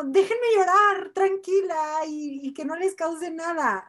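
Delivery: an exasperated plea to be left alone; pleading outburst. Retold or imitated. Wishing people would let her cry.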